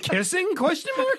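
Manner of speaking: very high squeaky mock voice. Joking conspiracy board guess.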